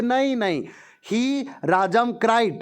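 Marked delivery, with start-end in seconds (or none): 0.0-0.6 half-hearted no. Retold or imitated. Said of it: "जैसे बोलते ना फॉर्मेलिटी के लिए".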